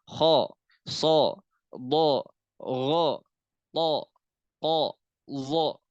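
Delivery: "kho", "so", "bo", "ro" voiced thick with a full o sound; heavy isti'la letters. Shown as a demonstration. Teacher drilling heavy letters for students. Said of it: "Khusodoktin kil".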